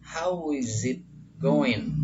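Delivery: teacher's demo of "goin" without the g; dropped G. Shown as a demonstration. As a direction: end "going" on n, no g. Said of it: "Jadi en".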